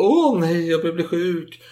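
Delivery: mock melodramatic moan; drama queen. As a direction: play the drama queen feigning illness. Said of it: "han är lite drama queen".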